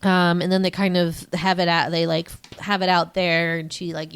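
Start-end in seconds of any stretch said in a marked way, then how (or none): none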